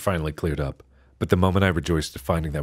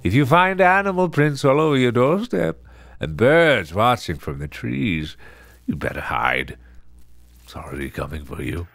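ominous narrator; 5 semitones higher than usual. Acted. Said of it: "a male voice was narrating".

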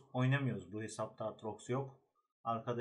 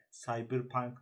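ordinary talk

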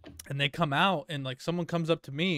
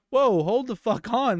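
slow, higher, louder protest; retold loud objection; 7 semitones higher, 5 dB louder than usual. Employees cutting off the lady's false accusation, loud slow protest. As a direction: stop her claim cold. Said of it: "so they're like".

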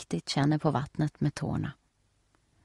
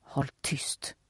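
sharp unvoiced hiss, much softer; 10 dB quieter than usual. A narrator's reading. Steffi hisses at Nelly to be quiet. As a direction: hiss it fiercely under your breath. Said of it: "väser Steffi".

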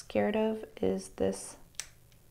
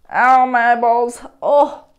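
loud ow of pain; pained yelp; 10 dB louder than usual. Real, not acted. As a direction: yelp at the pricking hair. Hair poking eyes.

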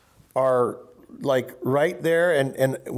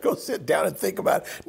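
laughing mock reply; 5 semitones higher, faster than usual. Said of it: "And you'd go".

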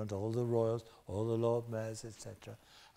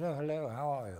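put-on posh London accent; faster than usual. Actor from Liverpool greets Michael, put-on London accent. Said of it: "with the Liverpool accent".

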